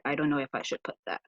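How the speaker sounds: normal talking voice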